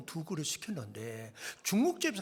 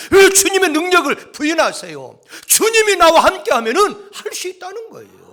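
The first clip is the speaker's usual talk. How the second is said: loud, high revival-sermon shout; full-force preaching; 10 semitones higher, 19 dB louder than usual. Real, not acted. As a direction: challenge the congregation with shouted questions and scripture. (큰 소리로 외치는 열정적인 설교)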